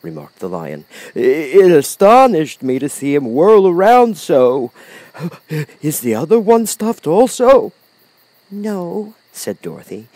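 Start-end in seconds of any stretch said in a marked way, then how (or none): 1.1-7.7 loud, high lion voice, stammering start; Cowardly Lion, astonished. A narrator's reading. 8.5-9.1 put-on high, soft child's answer; Dorothy, little girl. A narrator's reading.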